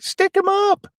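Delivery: very high, squeaky mock hold-up; tiny high voice. Hooks in voice training, very high timid squeak. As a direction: pipe it up in a falsetto squeak.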